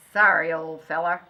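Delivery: a hard man saying sorry; regretful farmer. Acted. Farmer about to shoot dog.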